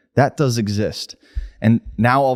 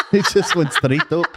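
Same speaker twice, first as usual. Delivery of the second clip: laughing through the words, rising higher; 9 semitones higher than usual. Real, not acted. Co-host laughing at his freezing joke, laughing. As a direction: crack up as you tell it.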